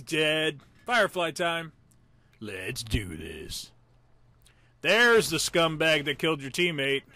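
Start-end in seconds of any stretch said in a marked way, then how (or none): 2.4-3.7 slow, deep, hushed battle cue; low, ominous resolve. Pretend. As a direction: drop low and drag it out. Psyching up for the fight.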